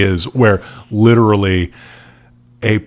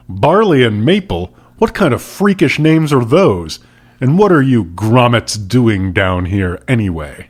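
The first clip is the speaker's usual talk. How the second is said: put-on scorn the kids as freaks; sharp rebuke; 3 semitones higher than usual. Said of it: "Boucher's sharp words".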